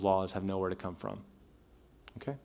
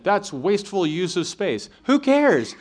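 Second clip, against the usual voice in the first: higher, slow then a loud, very high "Who cares"; mock dismissive; 9 semitones higher, 6 dB louder, slower than usual. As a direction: scoff like a careless god. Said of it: "as if he was saying".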